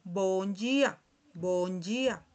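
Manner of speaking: bom dia as "bon JI-a"; Portuguese pronunciation. Shown as a demonstration. Teacher greets as Portuguese speakers do, bom dia as "bon JI-a". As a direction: model bom dia slowly with a ji sound. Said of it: "En portugués vamos a escuchar".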